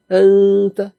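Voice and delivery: আনতা with nasal ghunna drawn out; Quranic pronunciation. Shown as a demonstration. Preacher demonstrating আনতা as recited in the Quran, nasal and lengthened. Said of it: "আল্লাহ পাক এভাবে উচ্চারণ করছেন".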